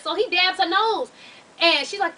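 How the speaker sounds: normal talking voice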